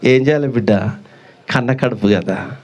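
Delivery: a low, helpless sigh of a mother; old mother answering her son, low and resigned; sorrowful old mother. Acted. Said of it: "అవ్వ అంటదా".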